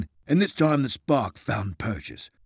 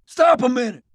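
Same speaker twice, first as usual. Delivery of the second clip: yelling; 11 semitones higher, 4 dB louder than usual. Acted. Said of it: "Isaiah yelled to James".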